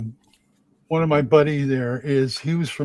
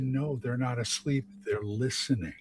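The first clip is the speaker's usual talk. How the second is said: quiet reply; 3 semitones lower, 10 dB quieter than usual. Retold or imitated. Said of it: "And I said".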